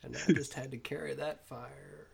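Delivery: quiet retold monologue. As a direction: hush it like the film's last scene. Tommy Lee Jones dream speech.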